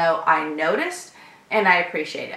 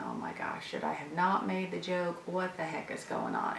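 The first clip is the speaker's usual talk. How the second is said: much lower and much softer, barely voiced; anxious inner thought; 4 semitones lower, 18 dB quieter than usual. Waiting for the customer's verdict, softly fretting to herself. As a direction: murmur your worry under your breath.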